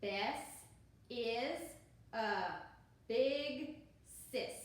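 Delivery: slow, word by word read-aloud for beginners. Shown as a demonstration. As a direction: point and read one word at a time. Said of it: "Next sentence".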